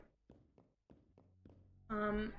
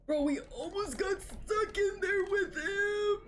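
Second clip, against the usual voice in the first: high, loud, shaky panicked cry; panicked yell; 8 semitones higher, 3 dB louder, much slower than usual. Real, not acted. Player after almost getting trapped, panicked high yell. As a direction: yell it out in real fright. Narrow escape from monster.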